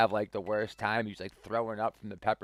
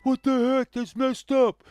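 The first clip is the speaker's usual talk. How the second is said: much higher and louder, mock indignant shriek; high angry yelp; 14 semitones higher, 9 dB louder than usual. Coworker with burning mouth yelling at pranksters, high mock fury. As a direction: squeal it like a burned, furious victim.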